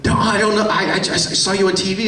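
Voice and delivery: lightly voiced excited stranger; eager fan. Fan explaining recognition.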